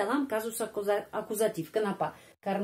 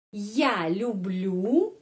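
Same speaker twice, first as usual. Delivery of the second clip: normal talking voice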